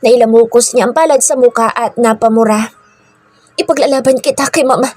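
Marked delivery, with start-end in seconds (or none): 3.5-4.9 high, urgent-to-convince plea; pleading. A narrator's reading. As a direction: beseech a loved one, voice pitched up. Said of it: "Pagsusumamoy niya". (nagsusumamo, mataas ang boses)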